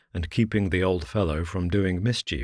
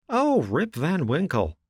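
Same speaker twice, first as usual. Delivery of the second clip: a higher, lightly voiced exclamation; exclaiming; 10 semitones higher than usual. A narrator's reading. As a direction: villagers recognizing the name, mild surprise. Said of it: "exclaimed two or three".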